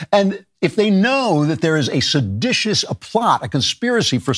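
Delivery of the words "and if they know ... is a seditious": ordinary talk, nothing marked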